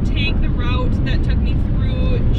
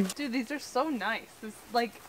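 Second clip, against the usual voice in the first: much softer, close to the mic; quiet; 16 dB quieter than usual. Real, not acted. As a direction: say it under your breath. Quiet remarks near camera.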